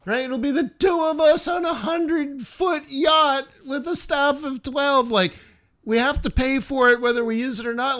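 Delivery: high-pitched, wheedling invitation, stretching key words; mock pleading. Rich friend coaxing someone onto the yacht, mock pleading. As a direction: beg in a high, put-on pleading voice.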